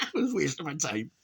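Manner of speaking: words squeezed out through laughter; laughing. Real, not acted. Still laughing about the failed prank. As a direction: talk while still giggling.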